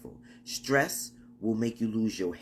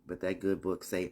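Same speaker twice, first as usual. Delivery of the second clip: ordinary delivery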